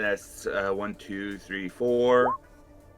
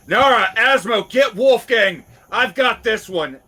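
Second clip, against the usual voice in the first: raised, higher battle call; yelling out; 8 semitones higher, 6 dB louder than usual. Acted. Player's character calling to allies mid-fight, loud urgent yell. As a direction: shout orders to your teammates. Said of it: "uh, yells out".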